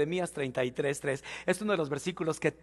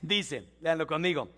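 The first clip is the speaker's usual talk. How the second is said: normal talking voice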